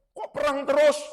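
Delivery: high, loud mock complaint; keluhan tiruan. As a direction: protest as if fed up with it. Menirukan keluhan orang soal perang. (keluhan kesal yang ditirukan)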